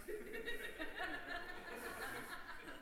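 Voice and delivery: a short high burst of real laughter; a sudden real laugh. Real, not acted. Laughs after "Surprise.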